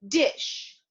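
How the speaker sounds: ordinary talk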